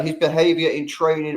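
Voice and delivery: ordinary talk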